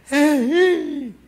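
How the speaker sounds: high, loud shrieking fan calling his name; screaming fan girl. Retold or imitated. Fan girl screaming for Mike, high shriek. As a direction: shriek like a fainting fan at a concert. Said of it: "La muchacha".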